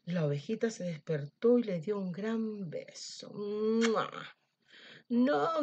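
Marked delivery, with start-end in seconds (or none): 3.3-4.1 kiss sound. Acted. Lamb gives a big kiss. 5.1-5.6 loud, higher cry of refusal; wolf shouts no. Acted. Old wolf refusing the kissing lamb, shouting. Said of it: "gritó el lobo".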